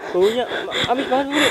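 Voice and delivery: loud disgusted exclamation. Real, not acted. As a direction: blurt out the disgust loudly. Reacting to the stench.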